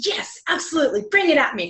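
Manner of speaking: high, keen and game; eager acceptance. Retold or imitated. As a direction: jump at the challenge. Retold eager reply to a challenge.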